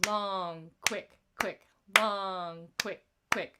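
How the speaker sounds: "Long" held, "quick, quick" short and crisp; long quick quick rhythm. Shown as a demonstration. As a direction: chant the long-short-short pattern. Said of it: "talk a little bit about the rhythm".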